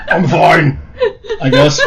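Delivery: breaking into laughter; laughing. Real, not acted. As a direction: laugh through the line. Cracks up mid-line.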